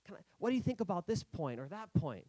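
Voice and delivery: lightly voiced nervous asking; eager newcomer asking. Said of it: "And so I was like".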